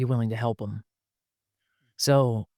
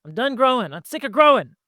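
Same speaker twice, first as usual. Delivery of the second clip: high-pitched mock whine, pushed louder; fed-up protest; 10 semitones higher, 4 dB louder than usual. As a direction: protest like someone pestered past bearing. Acts out person fed up with challenger.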